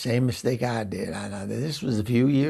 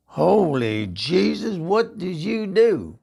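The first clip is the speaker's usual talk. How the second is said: slow, higher, louder horrified cry; shocked gasp; 7 semitones higher, 4 dB louder, slower than usual. Retold or imitated. [name] sees her botched thigh tattoo in horror. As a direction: drag it out in horror. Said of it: "I said".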